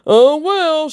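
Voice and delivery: imitating a careless shrug in the voice; mock nonchalance. Imitating the uncaring husband to his crying family, mock nonchalant. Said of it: "you were so nonchalant".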